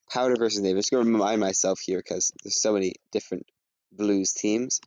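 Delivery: ordinary talk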